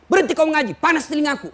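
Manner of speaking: high, loud mock outburst; acted irritated protest at a reciter; angry patient. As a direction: cry out as if your ears burn. Angry dying patient stops the reciter.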